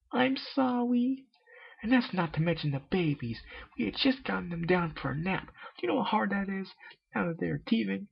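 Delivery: very high, shrill-toned tirade; a high mare scolding hard; scolding Miss Cake. Acted. Miss Cake scolds Pinkie in a very high tirade.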